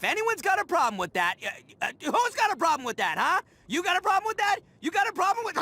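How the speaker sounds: getting worked up. Acted. Ito challenging critics, growing heated. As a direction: build into a picking-a-fight rant. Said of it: "I got a little worked up there".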